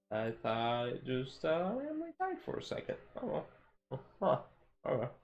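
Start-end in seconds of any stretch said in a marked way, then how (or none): none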